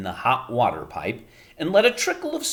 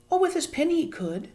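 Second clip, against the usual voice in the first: put-on high, light girl; a girl pointing it out; Arrietty voice. A narrator's reading. Arrietty answering.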